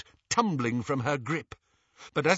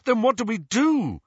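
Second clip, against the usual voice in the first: angry; 9 semitones higher, 4 dB louder than usual. Acted. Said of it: "glaring at the goblin".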